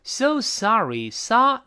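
"So sorry" slow, "sa" pitched high. Shown as a demonstration. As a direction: lift the first syllable of "sorry". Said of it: "sa读得比较高".

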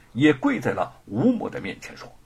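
plain reading voice

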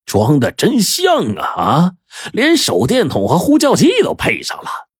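jeering, with pitch leaping up; mocking. A narrator's reading. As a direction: tease them with exaggerated false admiration. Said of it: "就揶揄地说".

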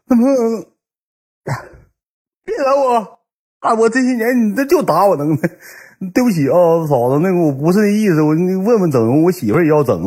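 high, whimpering apology; mock tearful. Beaten friend apologising to sister-in-law, mock tearful. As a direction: plead as if crying after a beating. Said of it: "别哭了".

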